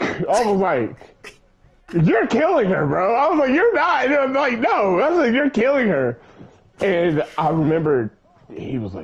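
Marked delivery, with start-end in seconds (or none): 1.9-6.2 higher, urgent pleading; alarmed accusing. Retold or imitated. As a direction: press him, alarmed. Accusing dad in retold scene.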